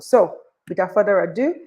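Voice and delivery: slow, drawn-out guest announcement; big stretched-out stage intro; show host introduction. Real, not acted. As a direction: build up to the name and stretch it out.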